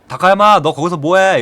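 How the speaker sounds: loud call across the schoolyard. Retold or imitated. Coach calling the student from behind, loud call. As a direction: shout his name from behind.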